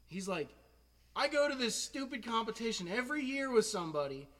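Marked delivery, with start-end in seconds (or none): 1.2-4.3 high, loud mock indignation; worked-up comic complaint; exasperated teacher. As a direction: imitate a teacher griping in disbelief. Teacher's exasperated reaction to the win.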